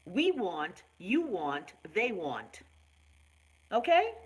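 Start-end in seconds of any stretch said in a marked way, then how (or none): 0.1-2.6 each pronoun set apart with "want" said deliberately; conjugation drill. Shown as a demonstration. Host teaching viewers to conjugate, slow and deliberate. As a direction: spell out "I want," "you want" like a teacher. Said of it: "Learn how to conjugate".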